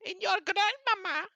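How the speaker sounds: very high, loud chirpy greeter; excited owl. Acted. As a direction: squeak it out like an eager little owl.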